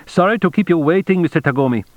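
high-pitched, officious clerk's apology; accented functionary. A narrator's reading. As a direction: apologize as a brisk consulate underling. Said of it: "At last the functionary's voice once again".

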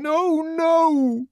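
very high, loud pleading no; frightened plea. A narrator's reading. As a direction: desperately beg him to stop. Linda pleading.

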